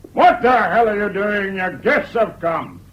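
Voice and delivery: impatient husband telling off his wife; irritated scolding. Acted. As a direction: bellow crossly at your dawdling wife. Scolds wife who has not come.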